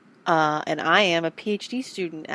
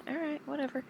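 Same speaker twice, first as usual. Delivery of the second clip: dismissive; 11 semitones higher, 7 dB quieter than usual. Retold or imitated. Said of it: "And I'm like".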